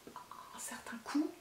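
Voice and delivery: slow, whispered "un certain coût" as a secret; hushed confiding remark about how pricey it is; whispered aside. Real, not acted.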